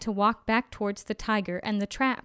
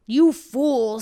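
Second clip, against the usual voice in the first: louder, irritated tiger; exasperated tiger; 6 dB louder than usual. A narrator's reading. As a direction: scold the dimwit.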